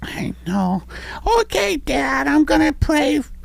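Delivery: put-on high little boy voice; kid voice. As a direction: play a sweet little boy. Child voice.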